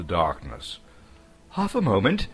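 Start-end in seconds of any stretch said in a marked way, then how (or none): 1.5-2.3 much higher, louder hobbit voice; Bilbo. Acted. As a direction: blurt the answer out high and proud.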